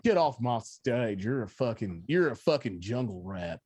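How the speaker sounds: slow, low Southern-accent mock tirade; mock indignant TV host with a Southern accent; Dr. Phil. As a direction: drawl the insults in a hammed-up Southern accent. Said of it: "Doctor Phil kinda lose his shit in that accent".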